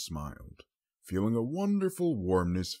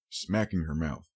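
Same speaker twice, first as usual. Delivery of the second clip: usual speaking voice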